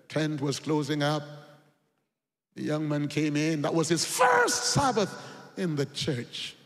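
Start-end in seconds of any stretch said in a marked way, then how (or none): none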